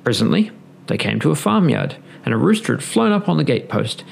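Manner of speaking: usual speaking voice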